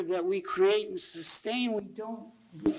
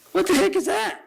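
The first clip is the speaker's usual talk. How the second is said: much higher, louder mock outrage; scandalized follower; 12 semitones higher, 5 dB louder than usual. Preacher mimicking followers shocked by Jesus' words, in outraged falsetto. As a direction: squeal it like an appalled disciple.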